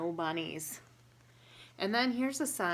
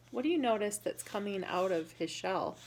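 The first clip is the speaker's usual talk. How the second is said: ordinary delivery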